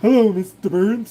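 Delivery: lightly done Homer impression; Homer Simpson. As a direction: greet the boss like Homer would. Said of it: "the Homer Simpson voice".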